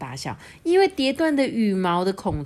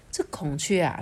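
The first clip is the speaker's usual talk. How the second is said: usual speaking voice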